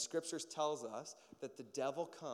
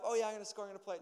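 put-on eager kid voice; 8 semitones higher, 4 dB louder, much faster than usual. Voicing eager toddler.

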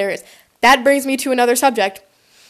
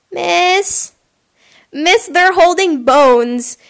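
put-on higher-pitched tattling kid; annoying kid; 6 semitones higher, much slower than usual. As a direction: draw it out like a kid telling on classmates. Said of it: "some annoying kid just was like".

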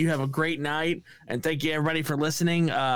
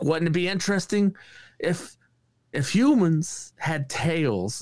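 odd star impression, higher, with long pauses; halting musing, stretching the final words; Christopher Walken; 5 semitones higher, slower than usual. Imitating a star posing an odd question to a comedian, halting impression. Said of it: "He's like".

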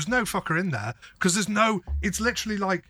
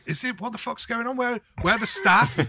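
baffled; 8 semitones higher than usual. Retold or imitated. Confused in an empty shop.

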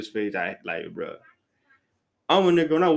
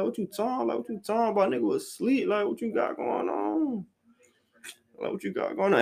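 high-pitched, puzzled demand; retold baffled questioning, pitched well up; confused questioning; 9 semitones higher than usual. Speaker asking shorty what her text meant, high confused questioning.

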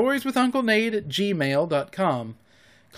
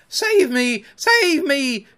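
high, loud plea for rescue; desperate high-pitched yell; crying out; 9 semitones higher, 5 dB louder, much slower than usual. A narrator's reading. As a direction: cry for help in a panic. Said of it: "cried Nibs".

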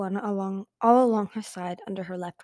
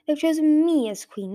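higher, louder indignant claim; snarled; 7 semitones higher, 5 dB louder than usual. A narrator's reading. Dragon queen claiming the throne from her sister, snarling. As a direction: insist angrily on the crown. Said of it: "Burn snarled".

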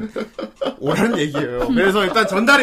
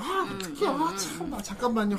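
soft, high-pitched stalling; imitated dithering; 7 semitones higher, 10 dB quieter than usual. Co-host mimicking how she usually hesitates at role-play, imitated dithering. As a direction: mimic someone too flustered to start. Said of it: "이렇게 뜸들이잖아".

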